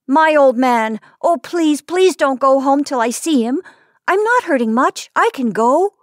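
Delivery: put-on high, urgent little boy's begging; pleading child. A narrator's reading. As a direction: beg them not to turn back. Hurt boy begs to continue.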